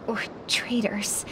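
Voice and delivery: hushed breathy whisper; whispered aside. Acted. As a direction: drop to a whisper, half to yourself. Grim aside about traitors.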